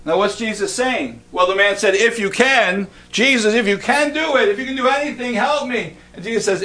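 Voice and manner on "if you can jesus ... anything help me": high, pleading voice of a desperate father. Retold or imitated. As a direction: beg like a worried father, raised high. Desperate father begging Jesus.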